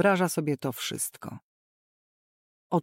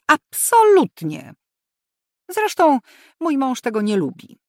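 loud, higher, final refusal; brooking no objection; 7 semitones higher, 8 dB louder than usual. A narrator's reading. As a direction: shut it down firmly. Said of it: "tonem nieznoszącym sprzeciwu".

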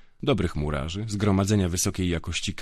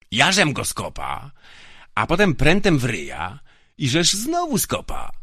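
louder, much higher oafish boast; drunken bragging; 8 semitones higher, 5 dB louder than usual. Acted. Drunk guard bragging about kicking the prisoner, loud. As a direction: relish telling the kicking.

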